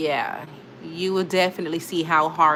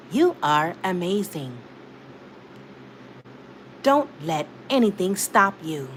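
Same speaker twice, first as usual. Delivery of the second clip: Siri voice. Shown as a demonstration. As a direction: recite it word by word like a computer assistant. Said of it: "I'ma do my Siri voice".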